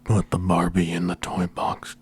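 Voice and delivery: creepy breathy whisper right into the mic; slow, breathy, lips on the mic; too close to mic. Pretend. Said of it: "Stop breathing in the microphone".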